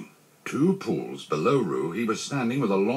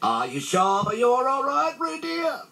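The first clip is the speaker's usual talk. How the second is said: high, slow, wailing call; fretful drawn-out cry; anxious mother; slower than usual. A narrator's reading. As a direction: call out long and high to a child. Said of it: "Kanga was jumping along the bank".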